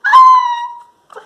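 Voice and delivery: short high shriek; scream. Acted. Reading a spooky story aloud, a piercing mock scream. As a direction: shriek as the hand touches them.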